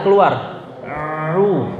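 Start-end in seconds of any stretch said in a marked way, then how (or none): none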